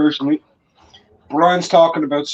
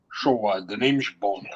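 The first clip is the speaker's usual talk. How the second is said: lower, softer mock Bond line in a put-on Scottish voice; Sean Connery impression; 7 semitones lower, 8 dB quieter than usual. Mocking Brian's supposed likeness to Sean Connery, low and soft Bond voice. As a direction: drop the voice low and purr it like a movie spy. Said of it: "you're saying he sounds like".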